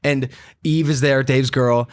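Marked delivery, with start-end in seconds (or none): none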